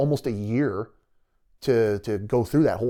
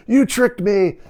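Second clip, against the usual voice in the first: happy disbelief; 10 semitones higher, 9 dB louder, much slower than usual. Retold or imitated. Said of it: "You're like".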